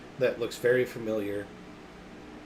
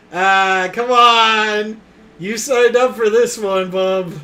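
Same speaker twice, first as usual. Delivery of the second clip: shouting, half laughing; loud mock protest; 9 semitones higher, 14 dB louder, slower than usual. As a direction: yell it like a fed-up gamer. Mock outrage at table.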